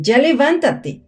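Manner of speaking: high, loud shout, drawn out; yelled order. Acted. Someone yelling at the sleeper to get up, loud. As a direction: bark her out of bed.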